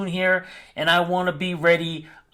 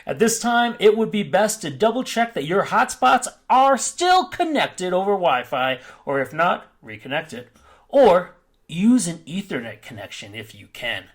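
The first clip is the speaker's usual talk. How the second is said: support voice; 3 semitones higher than usual. Pretend. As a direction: read it like an official tech support script. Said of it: "my, um, support voice".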